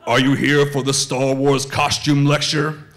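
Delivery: low, measured villain voice; Darth Vader. Acted. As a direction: intone it formally in a low register. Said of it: "He stops and he goes".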